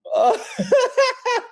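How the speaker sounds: shrieking high laugh. Real, not acted. Streamer cracks up over Jeff saying bucko, high squeal.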